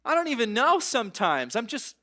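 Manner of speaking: anguished seeker. Acted. Preacher voicing a searching seeker at the well, high and loud. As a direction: cry out like someone lost and searching. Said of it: "who is saying".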